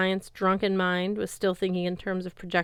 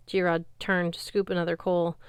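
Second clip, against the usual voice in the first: usual speaking voice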